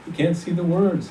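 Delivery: high, mocking echo of the words; mocking guard. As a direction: throw the words back at him with a sneer. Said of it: "He laughed. Oh, the German laughed".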